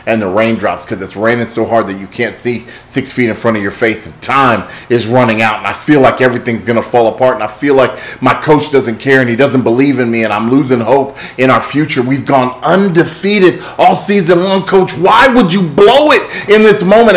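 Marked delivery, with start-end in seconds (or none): 4.0-14.6 building from tense to loud and high, faster; rising desperation. Retold or imitated. Retelling the losing final moments. 14.6-17.1 high, loud cry at the coach; desperate shout. Retold or imitated. Crying out at the coach.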